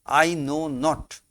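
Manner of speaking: slow, with "I know not" pressed firmly; emphatic "I know not". Shown as a demonstration. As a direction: stress "not" as a flat refusal. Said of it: "It is an emphatic statement".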